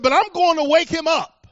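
loud, high-pitched proclamation; emphatic declaration. Retold or imitated. Jesus promises his disciples to wake Lazarus, loud and emphatic. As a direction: belt out the retold promise, pitched right up.

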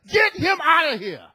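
high, loud mock shout; Sarah going off. Angry wife cutting off her husband's protest, shouting. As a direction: snap back as an enraged wife. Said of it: "Sarah going off".

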